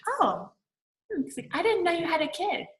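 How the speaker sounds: high, surprised quoting of the husband. Retold or imitated. Retelling the husband's surprised reply.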